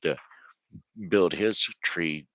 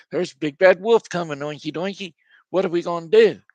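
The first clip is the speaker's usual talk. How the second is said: much higher, louder frightened pig voices; panicked pigs; 10 semitones higher, 8 dB louder, faster than usual. Acted. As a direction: cry it out in a mock panic. Pigs panic about wolf coming.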